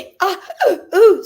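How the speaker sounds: high, loud vocal yelps; short high gasped ad-libs, half laughing. Retold or imitated. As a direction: imitate a pop star's breath hiccups. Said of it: "stuff that [name] was doing".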